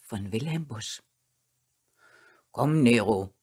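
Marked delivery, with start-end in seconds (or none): 2.5-3.3 low, loud master's command; stern dog owner calling his dog. A narrator's reading. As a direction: order it low and firmly. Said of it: "spricht Herr Barthel ernst".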